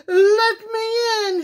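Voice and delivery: high, loud storybook wolf howl; wailing wolf, high and loud. A narrator's reading. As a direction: howl to be let in. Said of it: "he howled".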